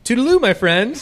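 high, loud sing-song toodaloo; silly farewell. Shown as a demonstration. Customer answering cashier with a loud goofy farewell. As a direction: throw them off with a goofy goodbye. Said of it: "Go like this".

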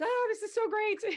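high-pitched, thrilled retold exclamation; delighted reaction. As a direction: act out an excited little squeal of approval. Retold delighted reaction to frank words.

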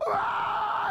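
high-pitched mock scream of pain; hammed-up yell from a crushed hand. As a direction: cry out high, acting out the agony. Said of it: "and I go".

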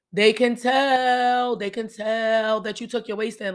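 drawn out, loud "tell" then settle; slow emphatic. Real, not acted. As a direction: stretch out "They can tell" loud and slow. Emphatic warning to ladies.

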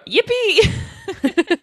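high-pitched playful cheer; mock glee. Reading out her note on the fingerprint find, mock gleeful. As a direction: squeal it in mock celebration.

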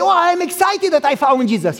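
high, hammed-up eager claim; forced excitement. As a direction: fake the excitement to win the point. Son faking excitement.

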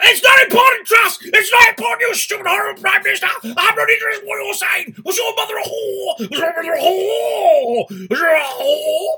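shrieking sergeant-major, very high and loud; sergeant-major screaming abuse at a recruit, high and loud; military drill sergeant. Acted. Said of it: "in a military voice".

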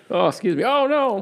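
high, flustered office worker. Pretend. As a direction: act embarrassed in a meeting. Acting flustered at fax, playful.

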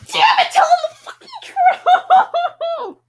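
high, slow, laughing yell at the screen; frustrated shout. Real, not acted. Reader yells at game character.

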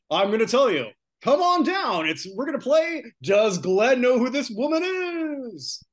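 loud, high announcer hype; game show host voice. Pretend. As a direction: call contestants down like a TV host. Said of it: "Game show host voice attempt here".

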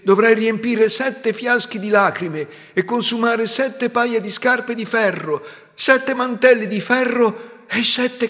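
high, loud furious proclamation; angry curse. Acted. Prince cursing his bride for breaking the spell, loud and furious. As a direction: hurl the curse at her in rage. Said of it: "pieno di collera ... gridò".

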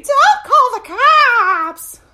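very high, drawn-out mock scream; screaming wife. Wife screams as husband fights everyone on the way out, imitated scream. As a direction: shriek it loud, stretching every word. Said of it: "The wife is screaming".

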